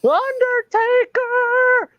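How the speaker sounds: very high, very slow wail; high hammed-up manager howl; Paul Bearer impression. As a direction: stretch each word in a high ghoulish cry. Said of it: "That's the best I can do".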